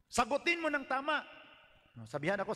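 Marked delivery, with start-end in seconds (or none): 0.1-1.2 pastor's demand. Retold or imitated.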